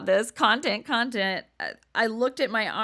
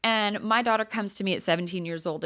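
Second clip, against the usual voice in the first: ordinary talk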